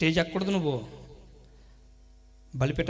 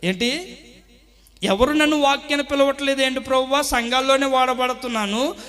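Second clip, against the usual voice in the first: higher, louder aggrieved pleading; complaining to God; 8 semitones higher, 9 dB louder than usual. Retold or imitated. Preacher acting out his complaint to God, aggrieved pleading. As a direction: plead with God, feeling overlooked.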